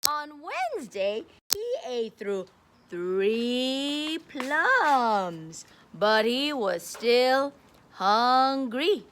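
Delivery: emphatic storybook reading, slow and loud in places; reading to children. A narrator's reading. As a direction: read it out big for a class of kids. Reading picture book to kids.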